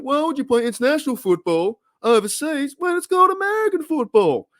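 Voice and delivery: high, hurried comic bafflement; incredulous stand-up rant; Jerry Seinfeld. Acted. Host riffing on overseas games as a baffled high-pitched comedian. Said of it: "trying to do my Jerry Seinfeld impression".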